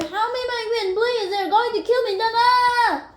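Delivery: high, frantic cries for help breaking into a cry; man dragged from the room screaming to his friend; terrified screaming. A narrator's reading. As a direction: beg for your life in terror. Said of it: "he screamed".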